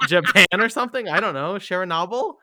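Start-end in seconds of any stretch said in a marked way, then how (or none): 1.5-2.3 accidentally French "share-a-NAH-bl" for Chernobyl, rising; slightly French Chernobyl, pitched up as a question; French-sounding version. Real, not acted. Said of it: "a very French version".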